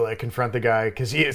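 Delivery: ordinary talk, nothing marked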